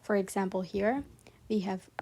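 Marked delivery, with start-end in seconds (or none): none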